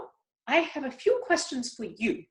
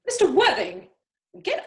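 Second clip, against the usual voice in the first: stern call; 4 semitones higher, 5 dB louder than usual. Acted. Mother catching a proposal.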